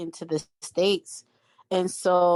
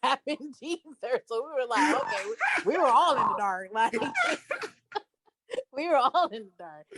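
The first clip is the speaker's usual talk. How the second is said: laughing; 7 semitones higher than usual. Real, not acted.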